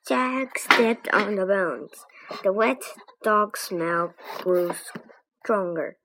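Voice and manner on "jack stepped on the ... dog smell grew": usual speaking voice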